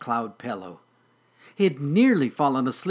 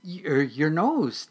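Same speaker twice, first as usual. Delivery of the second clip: slow, higher kitten voice; purring kitten; 4 semitones higher, 3 dB louder, much slower than usual. Acted. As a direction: purr it haltingly, up high. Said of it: "Noodle purred".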